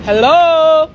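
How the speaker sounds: a loud, high "Hello?"; loud call-out. Real, not acted. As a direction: call out to whoever is there. Confronts someone filming him.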